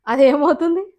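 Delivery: ordinary talk, nothing marked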